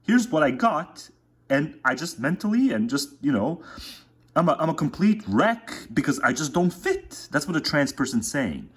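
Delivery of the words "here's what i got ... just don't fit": mock lament. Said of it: "It's like".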